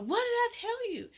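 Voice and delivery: loud, high angry mother; scolding. Acted. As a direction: snap at her daughter.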